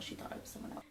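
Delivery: soundless whisper of self-comfort; whispered to herself. Real, not acted. Telling herself the woman was mistaken, a whisper. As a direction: whisper it to convince yourself. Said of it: "I'm just gonna tell myself that".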